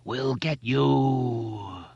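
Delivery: slow, drawn-out menacing call; bellowed threat. A narrator's reading. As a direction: call it down a long pipe at them. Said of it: "Henry bellowed down the sewer pipe".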